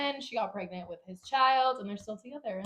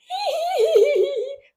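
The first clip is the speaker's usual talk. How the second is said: high burst of laughter; 13 dB louder than usual. Real, not acted. Laughing at her younger self.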